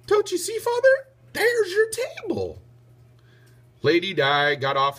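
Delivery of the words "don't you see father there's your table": put-on high little boy's voice; a small son chiming in eagerly; piping child voice. A narrator's reading. Radius speaks.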